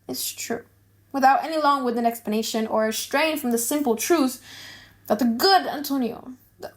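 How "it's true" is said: hushed, breathy whisper of gossip; confiding whisper. Acted. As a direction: lean in and whisper it.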